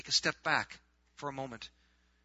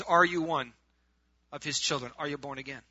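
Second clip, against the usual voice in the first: usual speaking voice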